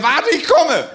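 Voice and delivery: high-pitched rescue call; heroic call. Retold or imitated. Friend coming to rescue the collapsed man, high call. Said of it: "Und dann meinte er".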